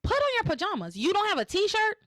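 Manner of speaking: high, incredulous mock outrage; exasperated scolding. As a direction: question his excuse in comic disbelief. Host mock-scolds the soap character.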